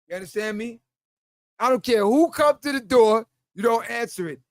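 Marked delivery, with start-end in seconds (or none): none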